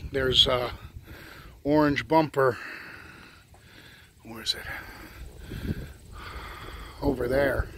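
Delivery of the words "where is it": whispering. Real, not acted. Hunting for hidden bumper quietly.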